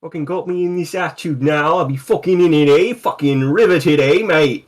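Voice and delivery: lightly put-on London accent, rough banter; mock Cockney. Player riffing at the table, mock Cockney accent. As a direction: play a cheeky Cockney yob.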